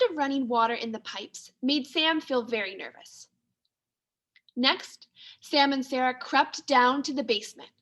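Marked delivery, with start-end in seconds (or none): none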